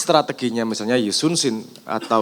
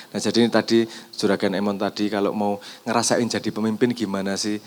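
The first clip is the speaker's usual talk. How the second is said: ordinary delivery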